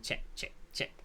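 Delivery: put-on hushed, clipped-back movie quote in his little accent. Fan quotes favorite poker villain, hushed imitation. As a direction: drop almost to a whisper, imitating the character. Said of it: "doing it in his little accent that he was doing it in".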